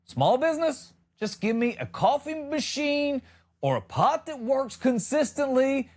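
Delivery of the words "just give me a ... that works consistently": usual speaking voice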